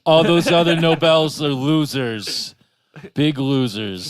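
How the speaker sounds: loud, boastful Trump impression; bragging like Trump at a rally. Mocking Trump, louder.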